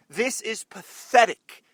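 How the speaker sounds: slow, loud, disgusted emphasis. Real, not acted. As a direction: lean hard on every word. Repeats line with emphasis.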